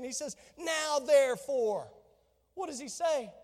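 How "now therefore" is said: "Now therefore" slow, every word weighted; emphatic determination. Acted. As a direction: lay it down with firm resolve. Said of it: "the Lord's emphatic, you know, determination".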